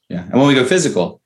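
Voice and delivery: ordinary talk, nothing marked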